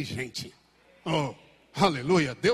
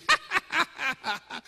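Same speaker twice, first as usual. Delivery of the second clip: mocking demon laugh; 9 semitones higher than usual. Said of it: "O pessoal ia expulsar ele".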